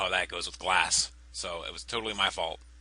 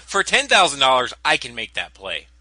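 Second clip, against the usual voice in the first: indignant loud; 2 semitones higher, 9 dB louder than usual. Real, not acted. Outraged at sixty thousand.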